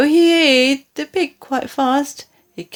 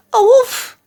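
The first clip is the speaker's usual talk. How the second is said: much higher, louder frightened pig; high squealing cry; scared cry; 13 semitones higher, 6 dB louder than usual. Acted. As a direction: cry out in terror. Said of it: "Pig cried".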